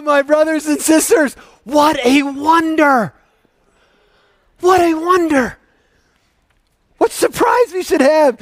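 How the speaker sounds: high, loud, rousing proclamation; loud, high exultant sermon; full-force preaching. Acted. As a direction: preach it with rising wonder.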